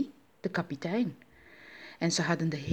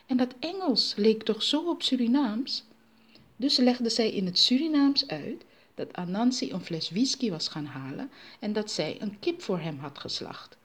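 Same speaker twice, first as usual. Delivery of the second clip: usual speaking voice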